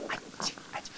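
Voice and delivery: soft breathless whisper; teacher whispering for quiet. Shown as a demonstration. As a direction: hush them in a whisper. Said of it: "不是这样".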